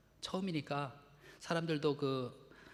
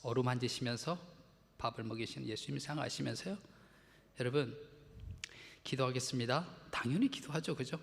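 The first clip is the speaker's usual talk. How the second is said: ordinary talk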